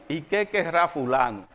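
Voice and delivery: low, quiet aside to oneself; wary suspicion. Acted. Seeing a long-absent relative at the door, quietly suspicious. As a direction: wonder warily under your voice.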